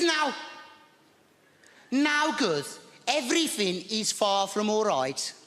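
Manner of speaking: mild Brummie neighbor in a fluster; Maud's voice. Retold or imitated. Neighbor Maud voiced in story.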